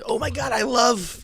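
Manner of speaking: high, slow squeal of adoration; hammed-up fangirl excitement; mock gushing fan. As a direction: gush like a clueless fan. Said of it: "who's not like a".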